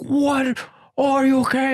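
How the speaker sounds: high falsetto, mock alarm; hammed-up high-pitched shock; shocked wife. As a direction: imitate a wife shrieking with worry. Retelling wife's shocked reaction.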